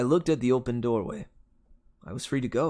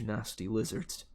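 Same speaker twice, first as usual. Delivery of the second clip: plain reading voice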